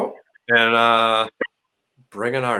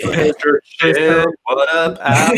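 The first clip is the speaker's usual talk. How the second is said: loud greeting; 4 semitones higher, 4 dB louder, slower than usual. Real, not acted. Greeting the new guest loudly.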